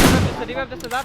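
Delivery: loud sudden warning call; alarmed shout. Real, not acted. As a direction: shout the alert fast. Alerting squad to enemy revive.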